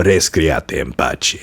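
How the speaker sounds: Italian phrase in a lightly imitated accent. Gamer quoting an Italian-speaking game hero, light Italian accent. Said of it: "speaking more in an Italian accent".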